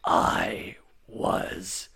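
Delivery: slow, creepy low whisper; hushed, creepy villain voice line. Acted. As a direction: draw it out in a low, haunted rasping whisper. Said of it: "like, a really creepy".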